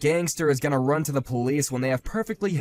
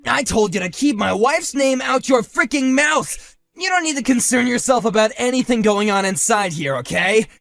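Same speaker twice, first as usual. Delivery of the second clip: angry shout; 6 semitones higher, 5 dB louder than usual. Acted. Husband warning the rival off his wife, furious shout. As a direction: spit out every word in rage. Wife's name mentioned.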